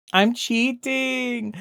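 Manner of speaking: drawn-out high sing-song, very slow; playful gloat. Real, not acted. Streamer admits cheating to chat, slow teasing sing-song. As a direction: stretch it out like a cheeky confession. Gleeful confession while placing art.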